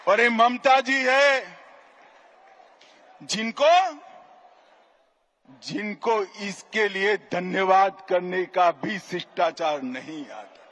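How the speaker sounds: normal talking voice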